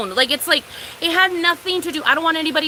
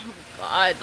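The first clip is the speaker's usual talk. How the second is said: low, soft sigh of dread; mock-weary private groan; retold dismay; 8 semitones lower, 9 dB quieter than usual. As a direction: sigh it out quietly, pitch dropped. Retells reaction to parking complaint.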